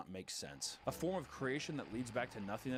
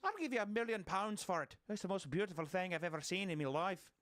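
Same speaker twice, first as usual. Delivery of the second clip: higher-pitched, hammed-up Irish accent; attempted Irish accent; 8 semitones higher than usual. Shown as a demonstration. Host plays the rich potato buyer, high mock Irish accent. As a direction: put on an eager, lilting mock Irish voice. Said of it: "what would an Irish accent be?".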